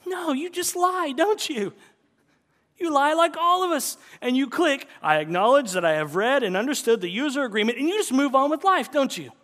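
high, loud playful scolding; mock-accusing preacher; teasing accusation. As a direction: call them out with a grin. Teasing the congregation about lying.

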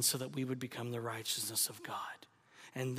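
fast, unvoiced whisper. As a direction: hurry through it in a hush. Hushed climax of the sermon.

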